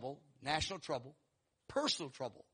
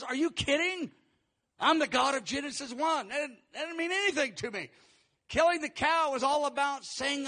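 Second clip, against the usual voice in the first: high-pitched, louder divine protest; exasperated God, voice raised; incredulous rebuke; 8 semitones higher, 6 dB louder than usual. Acted. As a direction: set them straight in emphatic disbelief. God rebuking proud givers, acted.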